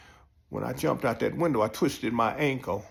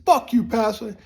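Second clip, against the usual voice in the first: cursing out; 7 semitones higher, 5 dB louder than usual. Retold or imitated. Cursing out the pastor.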